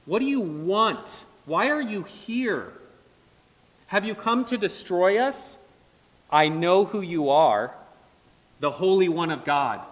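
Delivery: lightly acted demon, challenging and insistent; mildly agitated outburst, pressing each question; a hint of a cornered spirit's protest; demon's outburst. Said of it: "the demon's outburst".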